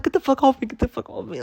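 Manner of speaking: high, shrill panic; panicked screaming. Retold or imitated. Hitting the attacking hawk, high panicked screaming. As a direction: scream at the bird in terror.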